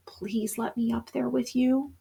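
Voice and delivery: soft, slow begging whisper-like plea; soft slow imploring voice. A narrator's reading. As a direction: plead gently like a lonely puppy. Dog's imploring eyes speak.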